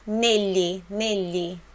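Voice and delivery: "Negli" with "gli" said like Portuguese "lhi"; gli sound. Shown as a demonstration. Teacher to students, showing "Negli" like Portuguese "lhi". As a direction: say "Negli" as if it were "nelhi". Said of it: "Tem o som do nosso L H I".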